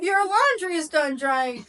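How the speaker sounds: slow high smart speaker voice; cheerful talking appliance. Retold or imitated. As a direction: chirp it like a home assistant. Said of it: "my freaking Alexa will go".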